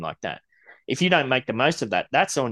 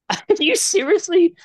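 incredulous; 5 dB louder than usual. Real, not acted. Incredulous at odd question.

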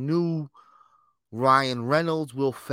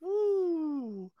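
high whoop; 4 dB quieter than usual. Acted. Cheer after intro.

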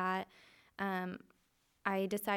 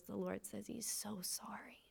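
soft, breathy retelling of a weeping prophet, mostly whispered; retold crying plea; 6 dB quieter than usual. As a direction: pass on his tearful apology under your breath. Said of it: "starts hysterically crying".

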